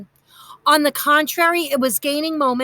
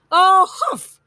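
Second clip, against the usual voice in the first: loud, higher disdainful snort; 6 semitones higher, 7 dB louder than usual. A narrator's reading. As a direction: huff in disgust. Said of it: "snorted Aunt Gladys".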